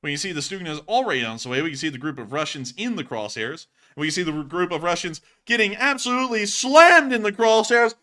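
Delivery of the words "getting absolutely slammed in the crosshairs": louder and higher, rising thrill; excited. Real, not acted. Narrator watching the Stugna hit the Russian group, getting excited. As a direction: get hyped as the missile lands. Strike lands, voice jumps up.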